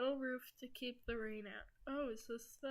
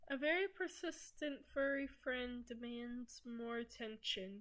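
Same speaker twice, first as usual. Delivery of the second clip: usual speaking voice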